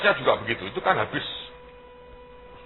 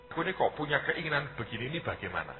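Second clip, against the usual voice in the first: soft questioning prayer; 11 dB quieter, faster than usual. Retold or imitated. Quiet inward prayer.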